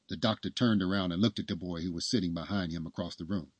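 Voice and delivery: plain reading voice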